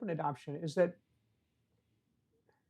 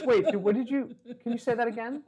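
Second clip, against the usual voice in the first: put-on puzzled child teacher; 8 semitones higher than usual. Child feigns not understanding pupil.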